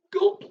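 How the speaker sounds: loud mock gulp, high; a gulping noise acted out; gulp sound. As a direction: act out a big gulp. Narrating a big gulp.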